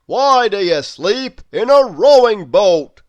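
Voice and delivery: big hearty question; Mister Strong voice. A narrator's reading. Narrator voicing Mister Strong asking about the boat. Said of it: "Mister Strong asked him".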